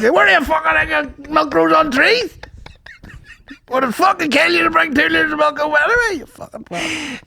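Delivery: mock furious shout. Son ranting at dad about the milk, hammed-up fury. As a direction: rage over the milk. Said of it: "lose their mind at everything".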